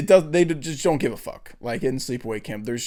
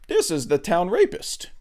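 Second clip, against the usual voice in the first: put-on higher-pitched comic foreign accent; Borat; 6 semitones higher than usual. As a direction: imitate the film's proud town guide. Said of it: "And then he goes".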